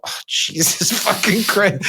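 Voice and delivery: slow, incredulous laugh-swear; reading an outrageous tweet aloud, laughing in disbelief. Real, not acted. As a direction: recoil with a laugh. Reacting while reading vulgar tweet.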